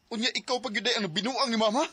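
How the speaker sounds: rising from firm to loud and high; building anger. Acted. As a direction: let the betrayal boil over. Son turns on lying father.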